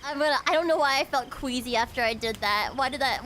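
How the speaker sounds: usual speaking voice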